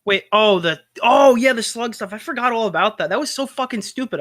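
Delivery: high-pitched burst of remembering, loud at first; sudden recollection. Real, not acted. Co-host suddenly remembering the forgotten Slug stuff, excited recognition. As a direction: exclaim as it all comes back.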